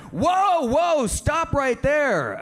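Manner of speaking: very high, drawn-out halting call; alarmed calling out. Acted. Bartender halts the trio walking in, high alarmed call. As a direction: stretch each word high to stop them.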